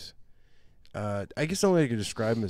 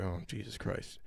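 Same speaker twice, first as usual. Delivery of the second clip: muttered; 2 semitones lower, 10 dB quieter than usual. Retold or imitated. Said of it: "so I was like".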